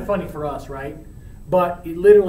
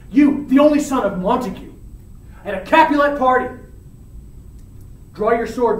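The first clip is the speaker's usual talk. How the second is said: raised, louder accusation; indignant acted confrontation; angry challenge; 5 semitones higher, 3 dB louder than usual. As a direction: hit each charge hard, demanding a duel. Tybalt challenges Romeo to fight.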